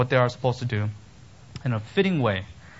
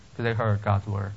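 normal talking voice

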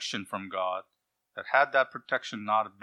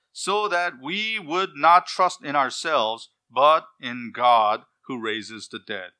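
preaching at full force; 2 semitones higher, 7 dB louder, slower than usual. Acted. Preacher proclaims scripture verse.

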